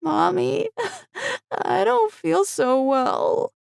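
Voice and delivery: put-on high, slow, crying little girl; a sick small girl whimpering; weepy child. A narrator's reading. Daughter telling mother she feels ill, high and crying. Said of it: "Tina had begun to cry".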